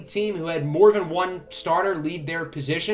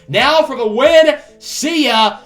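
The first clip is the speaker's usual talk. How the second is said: building, higher and louder home run call; excited play-by-play; 5 semitones higher, 5 dB louder than usual. Pretend. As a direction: count up to the winning hit, then let it rip.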